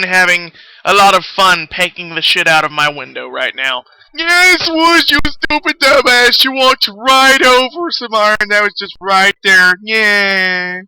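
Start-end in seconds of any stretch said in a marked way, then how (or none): 4.1-10.8 mock self-scolding. Scolding himself in another voice.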